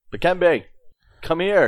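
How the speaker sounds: high, loud call into the dark; yelling a name. Acted. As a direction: shout the name across the room. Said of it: "I yell his name".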